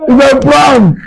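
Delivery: loud, high reassurance; urgent shout. Real, not acted. Prankster tells the panicking victim the truth, urgent shout. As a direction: shout it over the screaming.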